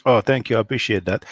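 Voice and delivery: ordinary talk, nothing marked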